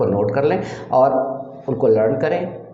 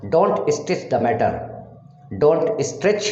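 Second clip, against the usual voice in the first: 1 slow demo; much slower than usual. Shown as a demonstration. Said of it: "teacher demonstrates English sentence".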